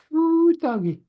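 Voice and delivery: sudden high yelp of disbelief; amazed whoop. Real, not acted. Player whooping at the table, high surprised yelp. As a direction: whoop it out, caught off guard. Player reacts to mistress converting.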